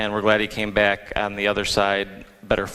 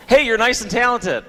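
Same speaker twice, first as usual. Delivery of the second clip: higher, louder mock-enthusiastic praise; hammed-up cheery holler of praise; mock shout-out; 11 semitones higher, 5 dB louder than usual. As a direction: call out the compliment high and loud. Mock answer to own question, called out.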